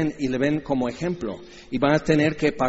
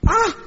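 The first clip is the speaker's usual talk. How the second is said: high mock scream; fleeing disciples shrieking; comic shriek; 5 dB louder than usual. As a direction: squeal as they run off.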